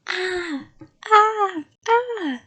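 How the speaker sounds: repeated high-pitched "ah" screams. A narrator's reading. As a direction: scream in short held bursts to frighten. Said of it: "I screamed".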